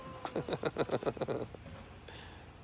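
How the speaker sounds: long, breathy run of giggles; soft chuckle. Real, not acted. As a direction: snicker quietly at your own joke. Laughs at own crude joke.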